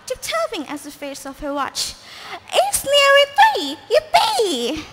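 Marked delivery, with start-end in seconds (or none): 2.5-4.9 higher, louder squeal of glee; gleeful witch. Acted. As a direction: whoop it as the witch. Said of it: "she cried".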